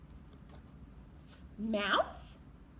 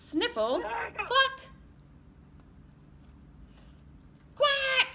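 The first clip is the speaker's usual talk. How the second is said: animal noises; 9 semitones higher, slower than usual. Acted. Teacher voicing farm animals while reading. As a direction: squawk it up high for the kids.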